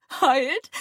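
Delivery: whimpered. A narrator's reading. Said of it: "she whimpered piteously".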